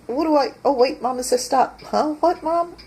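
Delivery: the dog is suddenly distracted mid-thought. Dog hears owner calling and turns, high puzzled voice. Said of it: "and it's like".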